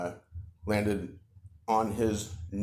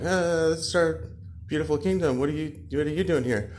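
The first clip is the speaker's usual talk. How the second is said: higher-pitched, hesitant little creature voice; timid creatures; 7 semitones higher than usual. Acted. Creatures questioning the intruding toad, hesitant. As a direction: answer uncertainly in a raised pitch.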